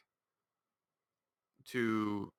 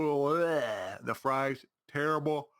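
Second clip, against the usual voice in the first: usual speaking voice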